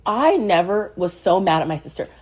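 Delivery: ordinary talk, nothing marked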